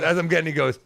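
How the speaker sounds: ordinary talk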